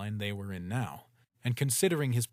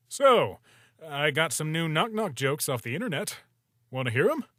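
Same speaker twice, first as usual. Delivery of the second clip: louder, upbeat joke pitch; forced cheer; 5 semitones higher, 7 dB louder than usual. Acted. Sans offering Toriel knock-knock jokes, forced cheer. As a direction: brighten the mood on purpose.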